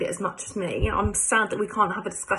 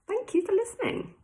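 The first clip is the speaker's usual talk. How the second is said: much higher, squeaky sign-off; really high pitched; 13 semitones higher than usual. Real, not acted. Teacher thanking the class, in a very high voice. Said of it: "That was really high pitched".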